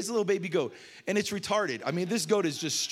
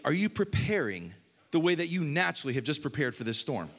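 ordinary delivery

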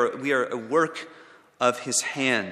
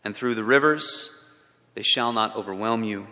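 scripture reading; 5 semitones lower than usual. Acted. Reads Isaiah's promise aloud.

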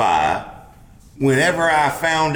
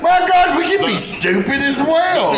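excited exclamation; 14 semitones higher, 4 dB louder, slower than usual. Retold or imitated. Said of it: "you were just sitting there like".

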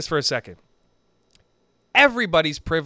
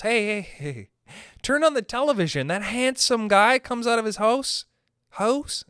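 higher, coaxing sales pitch to landlords; mock pitch; 6 semitones higher than usual. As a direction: sell it like a game-show host. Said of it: "say to those lovely individuals".

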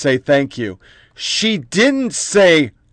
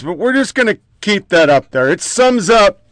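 usual speaking voice

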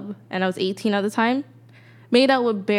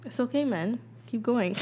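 quiet reassurance; 2 semitones higher, 10 dB quieter than usual. Real, not acted. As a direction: gently urge a friend on. Friend reassures stumbling reader.

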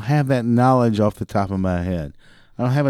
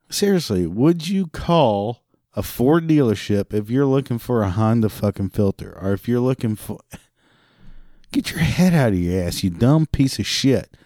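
ordinary delivery

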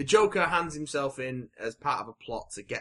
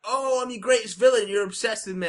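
high, louder hammed-up villain; Joker voice; 9 semitones higher, 6 dB louder than usual. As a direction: preen like a smug villain. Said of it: "Joker is all like".

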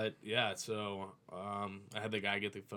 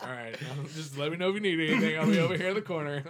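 mock meek reply; 7 semitones higher, 9 dB louder, faster than usual. Retold reply to the cops.

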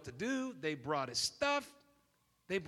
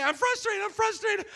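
much higher and louder, whiny; mock frustrated voice; 12 dB louder than usual. Preacher mimics a feelings-driven person, high and whiny. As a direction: whine it like a fed-up child.

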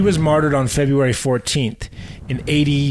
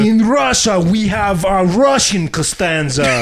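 higher, slow, laughing Russian announcer; mock Russian accent; 5 semitones higher, slower than usual. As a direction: ham up the In Russia joke. Yakov-style Russian joke.

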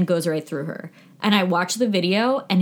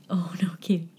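unvoiced whispered aside; whispering; 5 dB quieter, faster than usual. Real, not acted. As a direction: whisper it under your breath. Aside as cat approaches.

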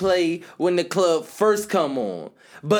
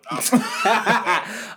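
laughing; 3 semitones higher than usual. Real, not acted. Laughing at friend's wine talk.

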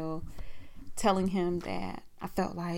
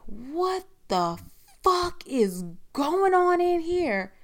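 raised, drawn-out indignant demand; retold outraged intruder; 10 semitones higher, 5 dB louder, slower than usual. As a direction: imitate someone bursting in, building louder and higher.

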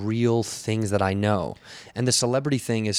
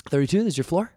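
higher, nervous attendant announcing the floor; claustrophobic elevator attendant; 7 semitones higher, faster than usual. Acted. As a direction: recover from panic to check the floor. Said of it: "world's most claustrophobic elevator attendant".

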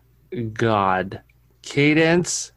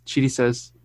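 ordinary talk, nothing marked

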